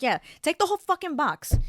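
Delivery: mock-generous snap; sarcastic outburst. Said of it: "I was like".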